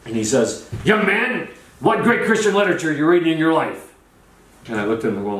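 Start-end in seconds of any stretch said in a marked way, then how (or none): 0.9-3.9 high, declaimed question; mock booming challenge. Charlie questions a young man before three thousand, mock booming challenge. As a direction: imitate a showman grilling someone on stage.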